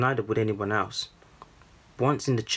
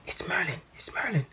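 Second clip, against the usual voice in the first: excited crowd whisper; 8 semitones higher, 3 dB quieter than usual. A narrator's reading. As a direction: whisper it urgently, as news spreading. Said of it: "the crowd whispered".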